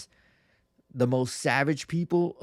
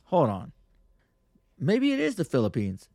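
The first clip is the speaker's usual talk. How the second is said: ordinary talk, nothing marked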